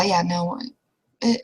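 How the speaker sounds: ordinary talk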